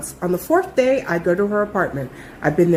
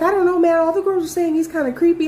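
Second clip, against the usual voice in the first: hesitant coworker voice; 8 semitones higher than usual. Retold or imitated. Voicing hesitant coworker Aranza.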